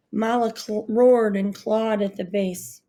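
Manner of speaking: ordinary delivery, nothing marked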